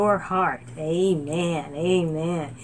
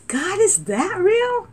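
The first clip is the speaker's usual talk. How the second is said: high, loud, slow amazement; high slow amazed cry to God; astonished cry; 13 semitones higher, 5 dB louder, slower than usual. Retold or imitated. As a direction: gasp out the question in wonder. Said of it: "I'm like".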